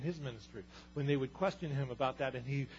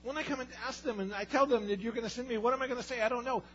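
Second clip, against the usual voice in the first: much higher, fretful and hurried; whiny brush-off; 10 semitones higher, 3 dB louder than usual. Retold or imitated. Moses fretting to God, high and whiny. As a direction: fret and wave it away.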